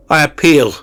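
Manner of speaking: shouted high and loud; loud cry. A narrator's reading. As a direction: cry out the appeal with full force. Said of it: "I cried with a loud voice".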